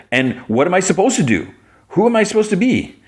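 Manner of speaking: high, theatrical mock despair; anguished protest. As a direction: play a hero at a loss, pitch climbing. Clark protests in acted argument.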